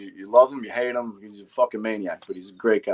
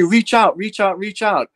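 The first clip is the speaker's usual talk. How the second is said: higher, louder repeated command, quoted; retold urgent order; 7 semitones higher, 4 dB louder than usual. Rookie retells firefighter's order at window, urgent imitation. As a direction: urge it on as a mock shouted order.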